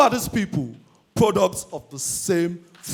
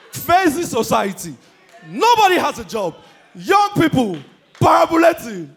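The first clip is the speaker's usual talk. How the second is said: loud, high ranting; rising rant getting louder; ranting shout; 5 semitones higher, 3 dB louder than usual. Real, not acted. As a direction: shout it at the crowd. Ranting at society.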